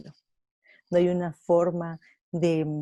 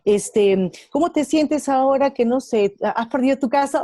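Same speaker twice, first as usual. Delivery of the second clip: loud, higher, eager questioning friend; curious friend asking; 5 semitones higher, 8 dB louder than usual. Retold or imitated. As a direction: call out the question to Marta like a worried friend. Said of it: "si uno de ustedes me dice".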